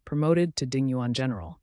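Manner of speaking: ordinary delivery, nothing marked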